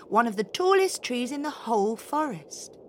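usual speaking voice

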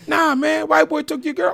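teasing cousin. Retold or imitated. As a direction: keep needling him. Cousin insists.

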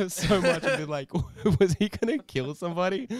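giggling at his own question; laughing. Real, not acted. Musing aloud to his co-host while laughing.

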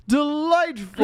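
high, loud, drawn-out mock delight; Jonathan. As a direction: gush slowly in a put-on high voice. Acts out Jonathan's delighted response.

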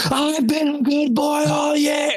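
high, slow, full-voiced childish shout; kid yelling. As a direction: yell it like a kid practicing for a deaf Santa. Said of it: "gonna fucking yell at Santa".

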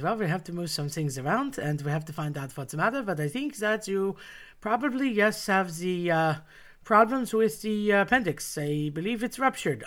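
put-on halting, thick German-accented doctor. German doctor explaining the diagnosis to a child patient, thick German accent. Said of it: "very thick German accent".

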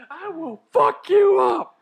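very high, loud, slow yell; Grossman threat yell. Retold or imitated. Friend acting Grossman's climax, slow high yell. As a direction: scream it out word by word. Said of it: "he goes".

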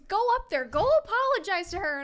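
loud, high scolding command; retold order. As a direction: order him out front loudly. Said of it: "And he goes".

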